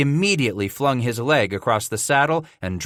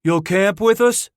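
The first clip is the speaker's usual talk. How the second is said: pleading; 9 semitones higher, 5 dB louder than usual. A narrator's reading. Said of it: "Solomon Fish pleaded".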